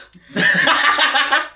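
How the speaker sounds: a high loud burst of laughter. Real, not acted. Roasting Drea as a gossip, a loud laugh. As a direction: crack up laughing.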